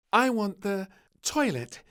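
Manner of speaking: put-on high, loud little girl's request; a child inventing excuses; little princess. Little Princess asking the King, high and loud. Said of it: "she said".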